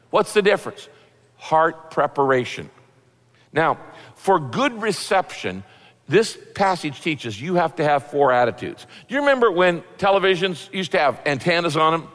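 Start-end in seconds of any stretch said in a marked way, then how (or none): none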